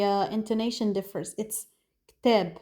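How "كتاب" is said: "كتاب" as "kteb", one syllable; Lebanese pronunciation. Shown as a demonstration. Teacher showing spoken Arabic for book, Lebanese pronunciation. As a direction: squeeze "كتاب" into "kteb", with an eh vowel. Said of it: "Using Lebanese dialect".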